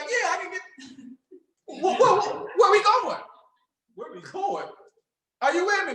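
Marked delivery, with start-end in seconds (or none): 0.0-4.7 higher, laughing, stumbling "wh-, wh-"; excited and laughing; eager laughing prospect. Retold or imitated. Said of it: "the people was like".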